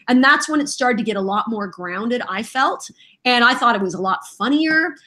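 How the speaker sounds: usual speaking voice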